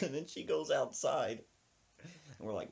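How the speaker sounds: usual speaking voice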